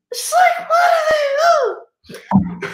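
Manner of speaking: squealed high, slow disbelief; mock outrage. Disgusted by delivery guys eating food, shrieking high.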